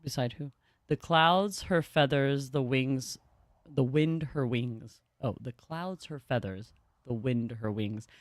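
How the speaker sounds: slow solemn reading of a hymn-like passage; story narration. Acted. Streamer reading the game's story to chat, slow and solemn. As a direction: read it out like a slow reverent litany.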